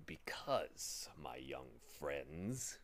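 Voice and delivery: low, drawn-out sour muttering. A narrator's reading. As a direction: mutter it slowly and sourly. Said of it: "he muttered sourly".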